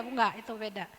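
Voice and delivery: ordinary talk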